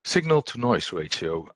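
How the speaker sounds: ordinary talk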